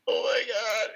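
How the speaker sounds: high, breathless wonder, voice going up; overwhelmed oh my God. Real, not acted. Moved by the tribute video.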